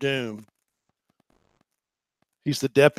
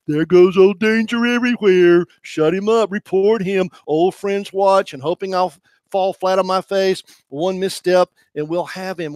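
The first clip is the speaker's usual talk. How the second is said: mocking gossip; 4 semitones higher, 7 dB louder than usual. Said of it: "I hear whispering behind my back".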